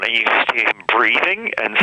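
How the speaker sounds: usual speaking voice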